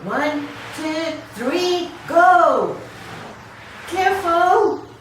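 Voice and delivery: high, loud urgent calls; urgent countdown. Acted. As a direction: call out to hurry across the road. Crossing the traffic.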